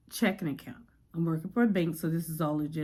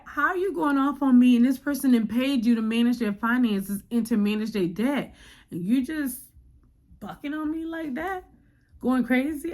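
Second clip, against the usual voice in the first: indignant; 6 semitones higher, 4 dB louder than usual. Retold or imitated. Inner voice, indignant rant.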